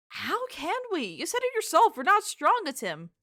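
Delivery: high, loud protest; loud, high-pitched objection; indignant protest. Acted. Acted protest to a pep talk.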